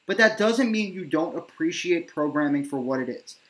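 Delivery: ordinary delivery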